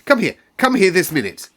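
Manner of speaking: put-on boy shouting for help now; urgent shout. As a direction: call him at once, furious. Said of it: "he shouted".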